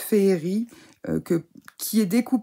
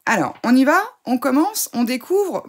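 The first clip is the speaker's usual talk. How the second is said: excited; 7 semitones higher, 6 dB louder than usual. Real, not acted. Eager start of unboxing.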